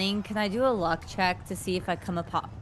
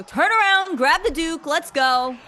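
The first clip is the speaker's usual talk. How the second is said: high loud shouted command; shouting at Rahi's back to fetch the Duke; yelling orders; 9 semitones higher, 5 dB louder, slower than usual. Acted. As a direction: yell it after her back. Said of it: "I yell at her back and go".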